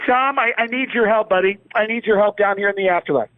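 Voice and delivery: high, fast pleading comic voice; Sam Kinison. Acted. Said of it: "Sam Kinison had been dead".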